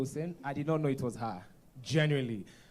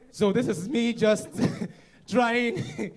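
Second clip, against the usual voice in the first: laughing; 8 semitones higher, 8 dB louder than usual. Real, not acted. Laughing retelling the threat.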